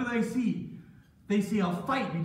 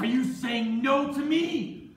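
loud, high, slow demand; outraged challenge; 8 semitones higher, 7 dB louder, slower than usual. Acted. Wife challenges the slave who refused her, outraged. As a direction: throw the question down in outrage.

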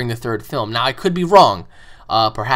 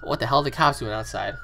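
normal talking voice

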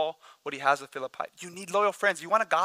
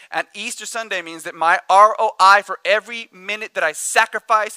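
preaching at full force; 4 semitones higher, 7 dB louder than usual. Real, not acted. Preaching climax.

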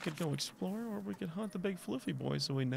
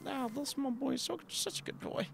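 cooing adoration; 8 semitones higher, 5 dB quieter than usual. Real, not acted. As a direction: coo softly over a beloved pet. Cooing over a pet creature.

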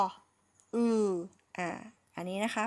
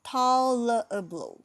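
slow model word; 3 semitones higher, 6 dB louder, much slower than usual. Shown as a demonstration. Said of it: "พูดให้เร็วขึ้นนะคะ".

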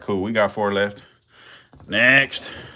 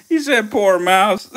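laughing; 11 semitones higher, 5 dB louder, slower than usual. Real, not acted. Laughing while quoting.

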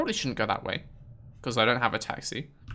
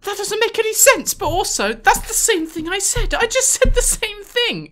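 much higher, louder exasperated rant; exasperated squeal; 6 dB louder than usual. Real, not acted. Player complaining the robot repeats his words, high-pitched. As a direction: squeak out the frustration.

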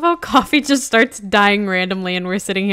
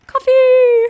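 shouting the name, high and loud; loud call; 12 dB louder than usual. Real, not acted. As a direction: yell it across the map. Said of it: "repeated call, louder take".

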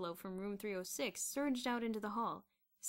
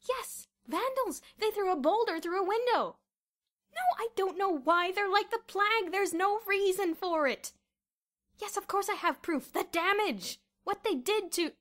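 high, loud outburst; furious rant; 11 semitones higher, 11 dB louder than usual. A narrator's reading. Headmaster shouting at Miss Scrimmage by phone, furious. Phone quarrel grows heated.

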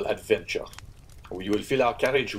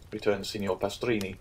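plain reading voice

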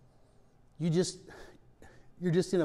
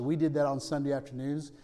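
ordinary talk

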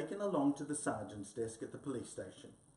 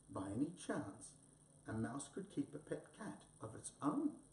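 usual speaking voice

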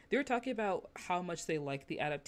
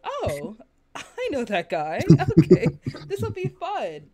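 re-enacted delight, higher and louder; light up with pleased surprise, a laugh in it; excited recognition; 6 semitones higher, 16 dB louder than usual. Acting out own first reaction.

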